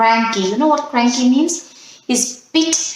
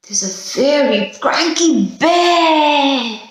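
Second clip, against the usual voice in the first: slow, raised storybook reveal; dramatic reveal; 5 semitones higher, slower than usual. Acted. Teacher revealing the cranky bear to children, dramatic. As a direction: unveil the bear with a flourish.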